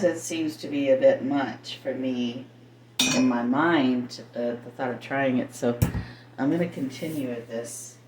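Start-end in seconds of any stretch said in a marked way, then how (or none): none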